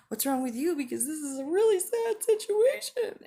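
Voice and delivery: hammed-up wail, very high and loud; mock overwrought distress, pitch climbing; severely sad. Speaker mimics someone in a severely sad mood swing, mock wailing. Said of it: "or severely sad".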